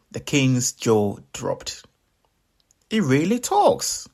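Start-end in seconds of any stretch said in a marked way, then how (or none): 2.9-4.1 high, loud astonishment; high amazed king voice. Acted. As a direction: gasp it out in wonder.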